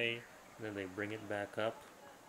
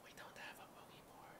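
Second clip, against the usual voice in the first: whisper yelling; 20 dB quieter, faster than usual. Real, not acted. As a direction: whisper it with force. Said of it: "Why are we whispering?".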